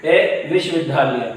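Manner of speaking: ordinary talk, nothing marked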